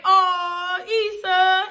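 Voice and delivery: high, drawn-out pitying coo; sympathetic aww. Retold or imitated. Viewer feels for the character left in rain, sympathetic. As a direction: coo with mock-tearful pity.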